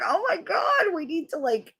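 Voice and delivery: high-pitched retold plea; mock pleading. Pleading with boyfriend to go elsewhere, mock urgent.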